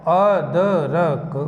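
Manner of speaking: अदरक read slowly and clearly. Shown as a demonstration. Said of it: "अब किसी बच्चे से कहें कि भाई ये पढ़ो". (धीमा स्पष्ट उच्चारण)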